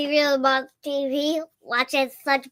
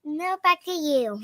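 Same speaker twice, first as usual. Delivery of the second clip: slow child's imitation of a sign-off; cute correspondent; 2 semitones higher than usual. Shown as a demonstration. Kid echoing parent as cute correspondent, slow and careful. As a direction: copy the reporter line, drawn out. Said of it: "I'll leave you to the cute voice".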